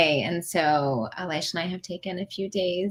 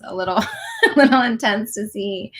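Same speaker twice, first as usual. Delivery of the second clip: real, small laugh while talking; amused chuckle; 4 semitones higher, 5 dB louder, faster than usual. Real, not acted. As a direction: laugh breaking into the words. Chuckles over graphic surgery photo.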